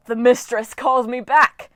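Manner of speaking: loud, slow, triumphant cry; gleeful shout. Acted. Storm spirit exulting as the vortex opens, gleeful shout. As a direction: crow it with wicked glee. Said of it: "Dylan shouted with glee".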